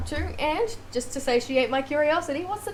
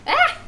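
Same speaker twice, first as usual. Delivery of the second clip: short, high, loud cry of shock; sudden acted shriek; startled yelp; 14 semitones higher, 6 dB louder than usual. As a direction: yelp as if caught out. Witness shocked by the clock.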